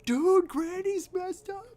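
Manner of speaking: very high, loud, slow silly dog voice; hammed-up cartoon dog. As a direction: squeal it out as the panicked dog. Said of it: "the dog is like".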